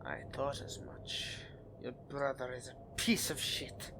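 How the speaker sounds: whispered then soft, confiding scorn; hushed, conspiratorial contempt; hushed disdain. Acted. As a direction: drop to a near whisper to share the insult. Confiding disdain about the brother.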